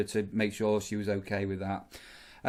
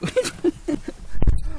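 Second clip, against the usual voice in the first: high laugh; 9 dB louder than usual. Real, not acted. Laughing at the story.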